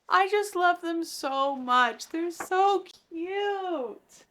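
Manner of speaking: high, slow swooning squeal; fangirl gushing. Real, not acted. Reader swooning over the sweet ending, high and slow. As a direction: melt over the couple, drawn out.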